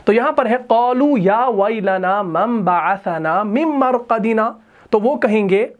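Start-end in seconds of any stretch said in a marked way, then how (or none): 0.7-4.5 Quran recitation. Shown as a demonstration. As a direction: recite the ayah in a melodic chant. Reciting the ayah.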